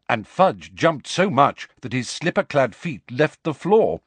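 ordinary talk, nothing marked

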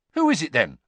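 loud, sharp demand; sharply. Acted. Ron demanding the secret from Ginny, sharply. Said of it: "said Ron sharply".

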